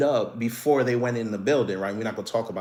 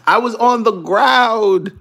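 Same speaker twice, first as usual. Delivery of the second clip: loud and slow; "on" stretched loudly and slowly; emphatic; 7 semitones higher, 6 dB louder, slower than usual. Real, not acted.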